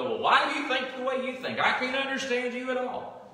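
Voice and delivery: a hammed-up exasperated retort; mock indignant echo. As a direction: mimic someone baffled, turning your words around on you. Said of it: "They look right back at you and go".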